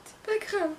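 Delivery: small, very high put-on voice; mock consoling. Vlogger shrugging off an annoying gadget, high mock-consoling voice. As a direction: squeak it out as pretend reassurance.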